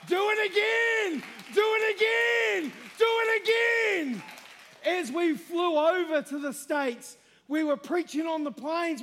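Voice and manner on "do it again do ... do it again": high, loud, building cry; praying out loud. Real, not acted. Preacher crying out to Jesus before the church, rising shout. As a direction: call out to God and build each time. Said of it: "calling on Jesus".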